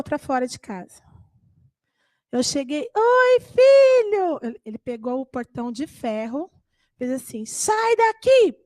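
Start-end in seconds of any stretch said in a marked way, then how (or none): 2.9-4.4 high, drawn-out cheerful hello; sweet greeting. Retold or imitated. Mother arriving home greets her toddler son, high and sweet. 7.4-8.6 put-on high, slow yelling little boy; a toddler ordering her out; angry child. Two-year-old son shuts the gate on his mother, high angry yell.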